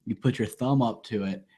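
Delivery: ordinary talk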